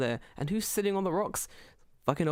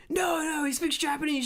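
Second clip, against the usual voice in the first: put-on old man; 12 semitones higher than usual. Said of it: "And he goes".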